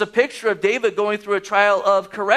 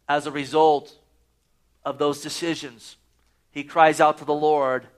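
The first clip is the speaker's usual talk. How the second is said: usual speaking voice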